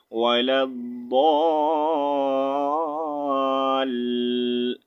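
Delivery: وَلَا الضَّالِّ held slowly and cut off at the لام; pause on the doubled lam. Shown as a demonstration. Teacher showing the pause on the doubled letter, slow chant. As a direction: hold the لام in الضَّالِّ, then stop. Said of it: "لام کے اوپر تھوڑا سا ہم جو ہے رک کر".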